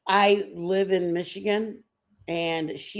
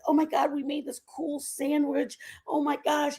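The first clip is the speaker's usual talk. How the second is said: higher-pitched retold delight; mock excitement; 7 semitones higher than usual. Mom recalls thinking they invented a sandwich, mock excitement. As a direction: gush lightly, as if proud of a discovery.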